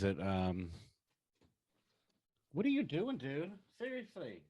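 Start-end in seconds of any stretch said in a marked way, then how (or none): 2.5-4.4 soft scold to cat. Real, not acted.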